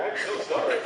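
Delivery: apologizing through a high laugh; embarrassed laugh. Real, not acted. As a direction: laugh it off while choked up. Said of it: "didn't know I was gonna be such a sissy".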